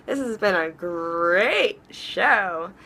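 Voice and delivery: cartoon-mascot stretch on "great"; slow sign-off, drawing out "great" with a swoop; Tony the Tiger. Vlogger thanking viewers for tuning in, mascot-style drawn-out "great". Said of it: "That reminds me of Tony the Tiger".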